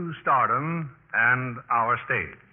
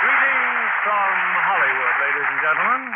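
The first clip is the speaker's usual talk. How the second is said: grand radio host welcome; formal host greeting; 4 semitones higher, 5 dB louder than usual. Acted.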